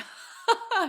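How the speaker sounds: bursts into a high, loud laugh; a genuine amused laugh; real laugh. Real, not acted. Mom recalls son's surprising question.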